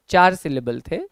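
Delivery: ordinary delivery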